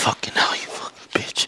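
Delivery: whispered cursing. Real, not acted. Cursing between reps, breathless.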